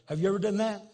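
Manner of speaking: ordinary delivery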